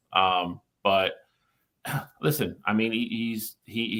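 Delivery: usual speaking voice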